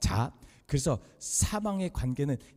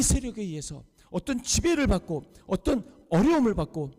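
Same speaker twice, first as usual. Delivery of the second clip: emphatic, raised preaching voice; forceful preaching; 8 semitones higher, 4 dB louder than usual. Acted. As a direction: drive each point home to the congregation. (힘주어 선포하는 설교조)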